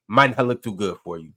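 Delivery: ordinary delivery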